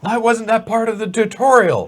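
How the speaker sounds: high, loud mock outrage; indignant complaint. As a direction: wail it in comic protest. Crawler complains about missing tutorial.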